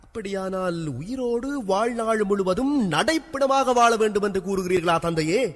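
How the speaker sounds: aggrieved son protesting; bitter challenge. Acted.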